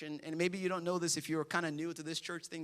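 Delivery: usual speaking voice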